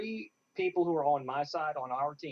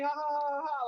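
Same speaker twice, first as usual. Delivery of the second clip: mock laugh; 10 semitones higher, 3 dB louder, faster than usual. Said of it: "being wild and crazy and ridiculous".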